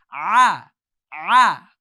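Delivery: "Ah, ah." loud with mouth wide open; throat ah. Shown as a demonstration. Teacher demonstrates the open throat ah. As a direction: open wide as if biting an apple. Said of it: "a guy opening his mouth".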